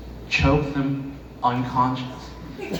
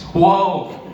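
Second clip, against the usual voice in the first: shocked whoa; 6 semitones higher, 6 dB louder than usual. Acted. Coworker shocked by racist remark.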